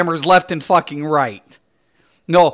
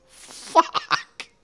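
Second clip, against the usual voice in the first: ordinary talk, nothing marked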